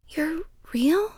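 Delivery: high, unvoiced whisper of wonder; amazed whisper. A narrator's reading. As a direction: whisper it in disbelief. Boy amazed by elf.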